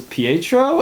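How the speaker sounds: mock stunned gasp of a name; shocked recognition. Wanda opens the door to her brother, imitated shock. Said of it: "Wanda says".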